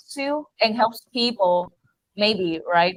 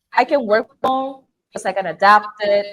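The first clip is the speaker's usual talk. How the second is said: ordinary talk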